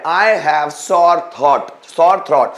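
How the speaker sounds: I have sore throat drawn out very slowly, word by word; slow model sentence. Shown as a demonstration. Teacher showing students a sentence, very slow and deliberate. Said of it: "तो English में क्या बोलते हैं?".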